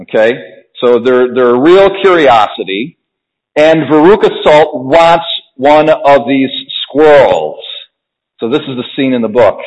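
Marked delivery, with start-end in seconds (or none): none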